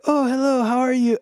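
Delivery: a light impression of a polite rock star saying hello; friendly greeting. [name] greets them, retold.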